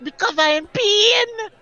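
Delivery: high, slow, exaggerated wail of pain; mock crying. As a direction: wail like a beaten child, hammed up. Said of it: "and I cry".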